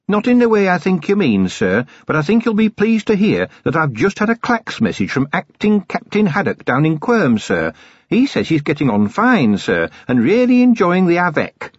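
high-pitched, upbeat report to the boss; dutiful dwarf sergeant, cheerful. Acted.

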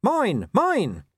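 small dog defending his master, barking high. A narrator's reading. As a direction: yap it out defiantly. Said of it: "He barked".

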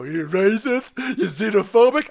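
mocking outrage. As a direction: sneer it like an outraged libtard. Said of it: "then they go".

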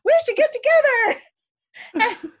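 high, loud, goofy puppet voice breaking into laughs; sock puppet voice. Retold or imitated. Aaron under the table voicing the sock puppet, high and goofy. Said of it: "and he's like".

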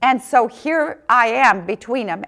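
ordinary delivery

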